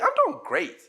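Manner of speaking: upbeat model answer; cheerful. Shown as a demonstration. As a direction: answer cheerfully, voice lifted high. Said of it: "make sure that you're cheerful".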